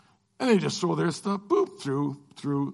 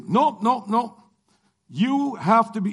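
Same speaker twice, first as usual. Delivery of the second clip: a higher, firm mock official saying nope; refusing officer; 5 semitones higher, 3 dB louder, slower than usual. Immigration officers turning him away, firm and higher. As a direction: play the border guard shutting him down, a bit higher.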